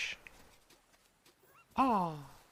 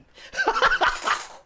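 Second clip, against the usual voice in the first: shrill burst of laughter; high squealing laugh; 4 dB louder than usual. Real, not acted. Laughing at trailer.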